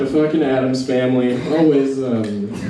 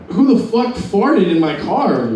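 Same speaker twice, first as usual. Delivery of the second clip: higher, drawn-out mock outrage; retold indignation; 7 semitones higher than usual. Comic retelling smelling a fart in his car, mock indignant. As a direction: demand it in puzzled indignation. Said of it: "and I was like".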